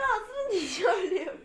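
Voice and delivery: high, breaking into laughter; laughing. Real, not acted. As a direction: laugh through the words. Laughing during no-laugh challenge.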